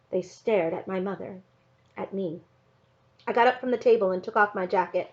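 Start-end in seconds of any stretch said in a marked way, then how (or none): none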